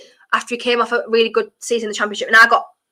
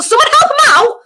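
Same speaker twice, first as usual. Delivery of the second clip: loud high shout; loud sharp voice; 7 dB louder than usual. Real, not acted. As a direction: yell at the screen in frustration. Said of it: "I'm so sorry for shouting".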